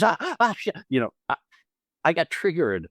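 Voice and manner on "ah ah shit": much higher startled cry, imitating a fright; startled yelp. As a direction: jump out of your skin, mock panic. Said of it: "my first reaction is".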